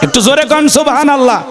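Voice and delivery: rallying shout to the congregation; calling out. Real, not acted. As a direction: call on the audience to shout it back. Urging crowd to respond.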